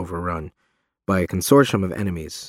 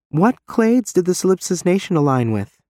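lightly higher questioning voice; Reeve's voice; 9 semitones higher than usual. A narrator's reading. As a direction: ask lightly, a bit higher. Reeve, female voice, questions Sam.

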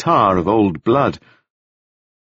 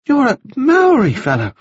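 put-on much higher, bright boyish voice; high bright young gentleman; 3 dB louder than usual. A narrator's reading. Wounded Staines greeting Taufari, high and bright. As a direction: lift it up high and bright like a dazed young toff. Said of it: "speaking in a voice that was high and very bright".

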